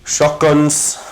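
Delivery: ordinary delivery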